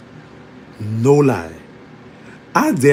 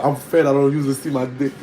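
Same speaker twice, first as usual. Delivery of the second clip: ordinary talk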